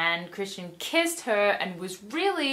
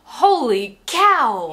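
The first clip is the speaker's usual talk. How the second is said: slow, louder hammed-up exclamation; mock gasp of delight; 4 semitones higher, 9 dB louder, much slower than usual. Heroine's inner voice ogling Jose, slow loud mock gasp. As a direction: drag it out like a swooning schoolgirl. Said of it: "her italics are thinking".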